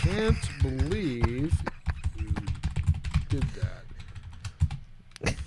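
slow, word by word with long gaps; halting, drawn-out dictation to yourself; typing aloud. Real, not acted. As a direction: say each word as you type it. Speaking words while typing them.

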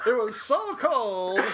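loud, high, drawn-out mock complaint; exaggerated exclamation. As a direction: stretch it out big and slow. Repeats punchline as exaggerated bit.

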